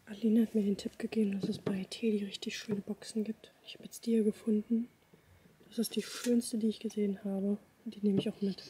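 hushed whisper in a shop. Real, not acted. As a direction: keep it to a breathy whisper. Whispering while out shopping.

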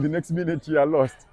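normal talking voice

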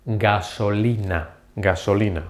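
gasolina slow and low, ga-so-LI-na; slow Spanish. Shown as a demonstration. Teacher modelling gasolina slowly. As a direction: draw out gasolina syllable by syllable. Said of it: "pronouncing them very slowly".

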